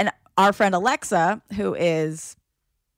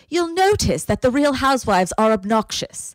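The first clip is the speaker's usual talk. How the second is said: higher, louder lecturing quote; mock explainer voice; 5 semitones higher, 4 dB louder than usual. Mocking a reality show explanation, pompous. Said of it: "it's like".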